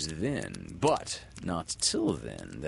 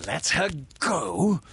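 loud, high rising question; incredulous cry; 10 semitones higher, 6 dB louder than usual. A narrator's reading. Hook hearing his captive was freed, crying out. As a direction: throw it back as an outraged question. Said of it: "cried Hook".

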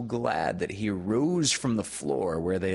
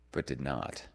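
plain reading voice

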